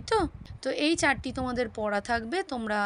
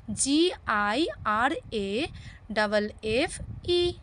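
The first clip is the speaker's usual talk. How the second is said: slow spelling out; much slower than usual. Shown as a demonstration. Spells word slowly.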